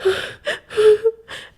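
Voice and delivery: high, loud mock sobbing; fake whimpering. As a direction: imitate a grown man whimpering into his palms. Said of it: "started whimpering into his palms".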